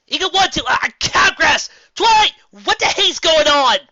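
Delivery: high, loud frantic yelling; panicked shouting. Acted. Rainbow Dash yelling over the explosion, high and frantic. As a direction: yell over the chaos to Twilight.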